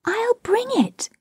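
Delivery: put-on high, girlish eager offer; eager cry. A narrator's reading. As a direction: pitch it high, keen to help. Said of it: "Wendy cried, always glad to be of service".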